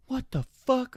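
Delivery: high, shocked exclamation; imitating a stunned, angry host; outraged disbelief; mock outrage. Angry host sees them.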